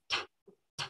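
crisp "t" released as a puff, no voice; isolated, whispered "t" for a pronunciation drill; voiceless t sound. Shown as a demonstration. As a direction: pop a bare "t" with no vowel. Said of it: "Ese es el primer sonido".

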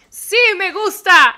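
mock delighted. Answering as game character.

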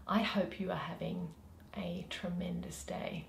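soft whispered greeting. Acted. Host whispering a hello to her viewers, hushed. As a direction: breathe the words out as a hushed hello.

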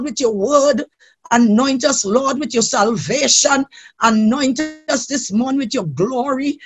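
normal talking voice

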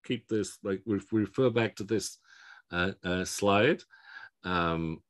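normal talking voice

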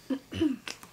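coughing fit on spicy food; sputtering cough, choking. Real, not acted. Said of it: "She's choking on it".

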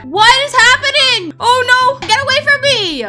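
high, frantic cries; panicked yelling. Real, not acted. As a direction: shout to fend off an attacker. Panics while chased in game.

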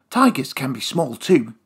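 plain reading voice

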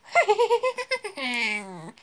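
a high hammed-up witch cackle; mock evil witch laugh; witch's cackle. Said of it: "That was meant to be a witch's laugh".

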